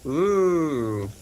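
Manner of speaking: ordinary delivery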